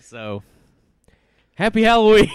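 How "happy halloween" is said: high, loud spooky greeting. Acted. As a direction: belt it out like a haunted host.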